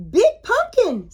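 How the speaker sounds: high, loud amazed mummy; excited storybook mummy; mummy marvelling. A narrator's reading. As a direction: exclaim in wide-eyed wonder. Mummy sees the huge pumpkin.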